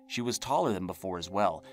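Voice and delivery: ordinary delivery, nothing marked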